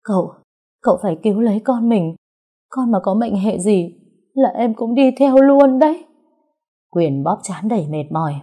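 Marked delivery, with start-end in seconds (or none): 0.0-6.0 rising, louder scared begging; a touch of fear, loud at the end; frightened plea. A narrator's reading. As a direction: plead in fear. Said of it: "sợ hãi".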